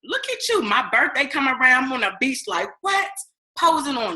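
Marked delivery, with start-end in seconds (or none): 0.0-0.6 hammed-up impressed admirer; admiring guest.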